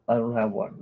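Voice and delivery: normal talking voice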